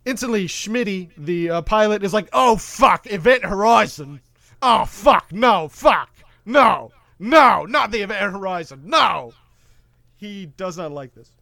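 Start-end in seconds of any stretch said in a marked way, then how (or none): 2.3-9.3 mock panic. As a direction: freak out at the ship's name. Pilot panics in retold scene.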